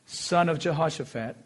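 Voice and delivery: ordinary delivery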